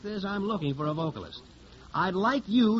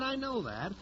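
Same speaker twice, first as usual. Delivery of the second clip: the second I know that dropped much softer, muttered; softer repeat; 4 semitones higher, 6 dB quieter than usual. Acted. Record man repeats himself to the singer, soft and weary. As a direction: let the repeat fall away under your breath.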